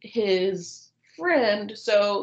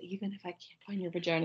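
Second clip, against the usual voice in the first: much softer repeat of the line; husband repeating his line, much softer; repeated quietly; 2 semitones lower, 12 dB quieter than usual. Retold or imitated. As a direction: say it again under your breath. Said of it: "he, like, repeats it".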